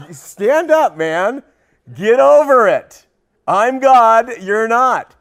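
a loud, blunt God telling Job to get over it; booming God. Retold or imitated. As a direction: shout it down as God putting Job in his place. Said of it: "God was like".

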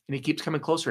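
normal talking voice

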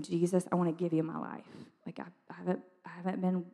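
soft, private bedroom prayer; quiet retold prayer. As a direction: recall a prayer, hushed and halting.

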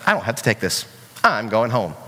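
low, sulky mock grumble; upset kid quitting. Kid quitting a backyard game, mock sulky. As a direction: drop the pitch and grumble like a put-out child. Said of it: "They just get so upset".

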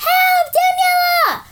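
high loud cry for help; calling for help. Acted. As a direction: shout to the rescuer. Calling rescuer from runaway horse.

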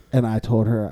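normal talking voice

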